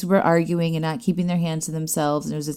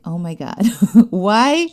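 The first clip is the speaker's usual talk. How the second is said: amused exasperation; 4 semitones higher, 6 dB louder, slower than usual. Retold or imitated. Retold exasperation at whining kids, laughing.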